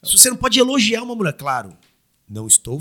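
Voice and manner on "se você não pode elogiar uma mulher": heated rant. Real, not acted. Ranting about touchy world.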